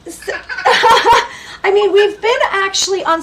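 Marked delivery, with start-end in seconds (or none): 0.4-1.6 a high, loud embarrassed laugh; bright laugh. Real, not acted. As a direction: laugh off the awkward moment. Host laughs at photo remark.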